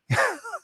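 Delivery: short laugh, high and soft; a quick real laugh at the joke; amused high laugh. Real, not acted. Laughing at the caller's webcam joke, high soft laugh.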